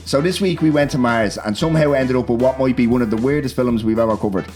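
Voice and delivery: usual speaking voice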